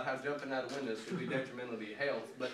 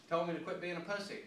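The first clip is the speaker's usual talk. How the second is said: ordinary delivery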